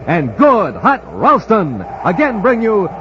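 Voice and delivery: ordinary delivery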